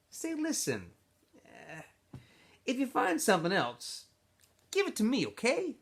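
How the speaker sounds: a smooth-talking camp leader pulling him aside; X-Ray's voice. Acted. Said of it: "said X-Ray".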